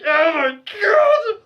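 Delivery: high, loud drawn-out cry of dread; hammed-up horrified cry; horrified wail. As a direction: wail it in mock agony at being caught. Caught out, horrified reaction retold.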